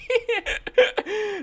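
high-pitched giggling fit; laughing. Real, not acted. Cracks up over the game.

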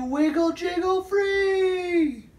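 high joyful rising shout; excited shout. A narrator's reading. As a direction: whoop it out.

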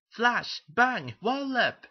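high, punchy shout, last word stretched; excited shout. Acted. Thomas shouting as the photographer takes the picture, excited. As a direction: call it out high and gleefully. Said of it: "shouted Thomas".

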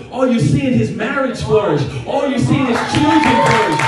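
ordinary talk

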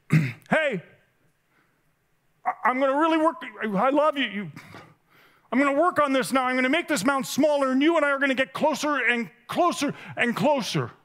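high, pleading promise to do better; pleading yell. Retold or imitated. Preacher voicing himself begging Jesus, high and pleading. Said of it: "I wanna yell out".

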